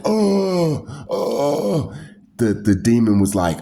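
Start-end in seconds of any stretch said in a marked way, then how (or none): 0.0-1.9 high, drawn-out dazed moaning; crazy street person moan. Retold or imitated. A homeless man wandering, moaning high. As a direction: moan like a lost soul on the street. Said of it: "they just be like".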